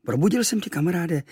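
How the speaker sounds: high, reproachful island friend; Kvíkvék's voice. Acted. Kvíkvék telling his friend he woke him, reproachful. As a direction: chide your friend gently but high.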